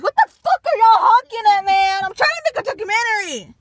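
high, loud furious yell; angry shout. Real, not acted. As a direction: yell at the honking drivers. Yelling at honking cars.

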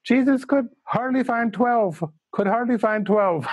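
high Indian English voice, solemn; old Indian teacher in Indian English accent. Retold or imitated. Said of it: "in his Indian, Indian English accent".

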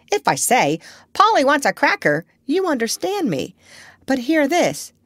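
high parrot demonstrating; proud parrot. A narrator's reading. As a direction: show off to the doctor. Parrot showing off languages.

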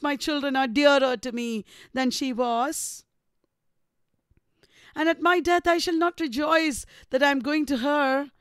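emphatic, theatrical reproach with questioning rises; bitter Catherine. A narrator's reading. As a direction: plead and accuse, line by measured line. Reads Catherine's bitter speech.

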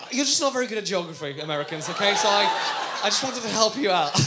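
normal talking voice

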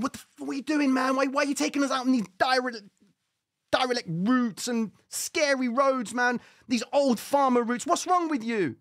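high, loud mock tirade; hammed-up indignant yelling at the driver; outraged confrontation. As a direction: protest at the top of your range.

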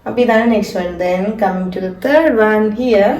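ordinary delivery

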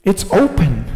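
anguished discovery, lightly acted; dismayed Mary. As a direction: voice Mary finding the tomb open. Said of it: "Mary runs up to the tomb and's like".

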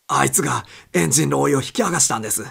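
panicked fast telling; agitated. Acted. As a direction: rush the words out in alarm. Wilson blurts report.

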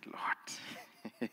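unvoiced hushed confession; whispered prayer. Preacher whispering his own prayer to the Lord.

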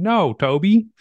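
high, loud American accent impression. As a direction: put on an American client's voice. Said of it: "You know, American voice".

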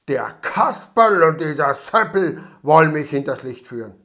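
loud angry growl. A narrator's reading. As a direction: snarl it through anger. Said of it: "knurrte er".

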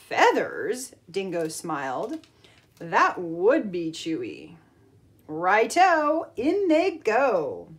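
put-on lively storybook read-aloud for kids; reading to children. A narrator's reading. Teacher reading a picture book to the class, lively storytime voice.

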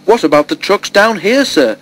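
high-pitched, worried question; anxious. A narrator's reading. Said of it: "Toby asked anxiously".

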